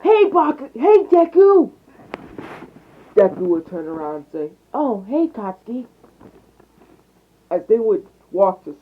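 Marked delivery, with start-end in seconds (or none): 0.0-1.7 put-on high, loud excited kid's shout; shouted greeting, pitched way up; calling out. A narrator's reading. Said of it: "would run up to him and say". 4.7-5.9 lightly voiced kid, pitched higher; higher-pitched hello to a friend; friendly greeting. A narrator's reading. Boy greets friend back in story.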